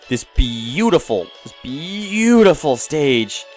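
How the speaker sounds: slow, savored stress on "beautiful"; gushing emphasis. Real, not acted. Player praising this beautiful stage, gushing.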